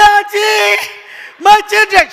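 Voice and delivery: high loud pleading cry; desperate plea. Retold or imitated. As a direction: cry out to her. Pleading with sister for children.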